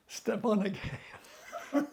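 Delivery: slow retold order breaking into laughter; tickled by the memory, laughter creeping in; laughing. Real, not acted. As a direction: quote it slowly, then laugh. Chuckles retelling his own order.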